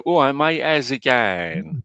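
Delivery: drawn-out Cockney greeting; Cockney accent. Shown as a demonstration. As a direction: greet a mate in East End Cockney. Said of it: "I start talking a bit like that".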